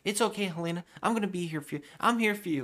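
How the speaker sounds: high-pitched imitation of a doting man; hammed-up high-voiced comforting; mock reassurance. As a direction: coo it way up high, over-eager to soothe her. Retells him soothing the panicking woman.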